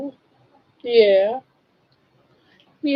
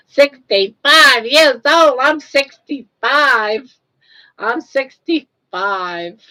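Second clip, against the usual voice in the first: slow, drawn-out mock old lady voice; playing an old lady for the camera, slowly; 5 semitones higher, 8 dB louder, slower than usual. Playful creaky old woman. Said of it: "Try to talk like an old lady".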